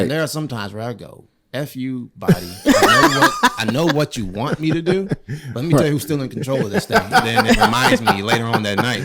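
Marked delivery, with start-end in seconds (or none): 1.5-7.0 laughing, loud, high taunt at his body; mock defiance to body. Man scolding his own body, laughing and loud. 7.1-9.0 laughing. Real, not acted. Laughing while telling.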